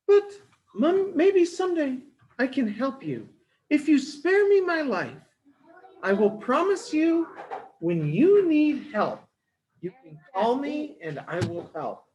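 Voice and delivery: very high, small pleading squeak; squeaky mouse voice. Acted. Mouse bargaining with the lion, high squeak. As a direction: beg sweetly as a tiny mouse.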